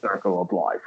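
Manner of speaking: lightly voiced Scottish lion father; Sean Connery. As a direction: tail off in a mock Scottish accent. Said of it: "how Sean Connery was lined up".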